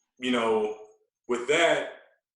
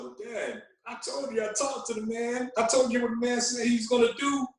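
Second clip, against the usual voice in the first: rising, higher pitched protest to dad; exasperated inner reply; 10 semitones higher than usual. Retold or imitated. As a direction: plead the point, voice climbing. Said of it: "I thought to myself".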